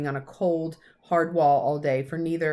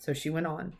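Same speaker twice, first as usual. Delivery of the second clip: usual speaking voice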